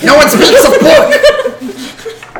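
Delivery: high, loud, slow creature shriek; goblin outburst. Acted. Goblin hears forbidden name, high creature shriek. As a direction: erupt in a drawn-out creature cry.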